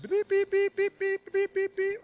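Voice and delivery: quick string of high, loud beeps; Morse code beeps. Retold or imitated. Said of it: "ordering via Morse code".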